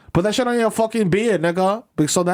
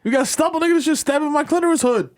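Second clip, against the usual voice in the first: pained woman; 8 semitones higher than usual. Pretend. Voicing woman complaining of stubble.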